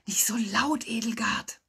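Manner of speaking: urgent whispered reprimand; hissed whisper. A narrator's reading. Professor hushing Edelgard at the pool, hissed whisper. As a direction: hiss it under the breath. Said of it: "zischten der Professor und Liege fünf".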